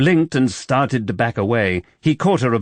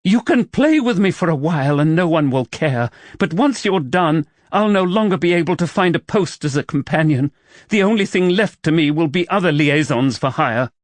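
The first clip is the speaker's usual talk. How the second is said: higher heroine voice, upset; woman's voice; 7 semitones higher than usual. Acted. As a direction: voice her, pained and bitter.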